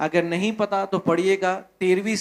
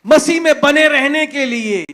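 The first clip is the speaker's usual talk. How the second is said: louder and higher, preaching at full force; preaching shout; 5 semitones higher, 6 dB louder than usual. Real, not acted. प्रचारक सभा से, ऊँची और ज़ोरदार पुकार में. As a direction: build to a fiery shout for the congregation.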